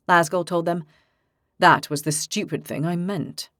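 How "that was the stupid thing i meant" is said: ordinary delivery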